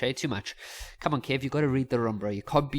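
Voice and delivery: ordinary delivery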